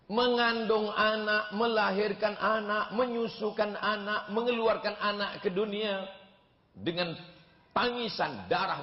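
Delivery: high, slow, fervent build through each step; slow, lifted sermon cadence; impassioned preaching. Acted. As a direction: preach it, rising with each line. Preacher building list of mother's suffering.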